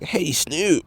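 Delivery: high-pitched, silly nasal call; mock nasally voice. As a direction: put on an exaggerated congested voice. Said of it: "lock in with the voice so I don't sound".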